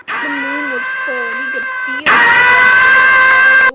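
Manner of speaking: very high, loud, slow wolf howl; over-the-top howling shout; hammed-up howl.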